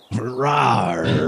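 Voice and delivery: mock jaguar growl; a silly little big-cat roar. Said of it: "do a quick practice right now".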